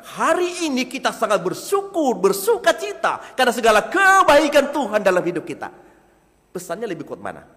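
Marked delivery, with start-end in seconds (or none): 0.0-5.7 much higher and louder, ringing with joy; expressive tone. Shown as a demonstration. Preacher showing the congregation an expressive, joyful delivery, high and loud. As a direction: proclaim it with lifted voice. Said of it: "karena ton suara saya berbeda".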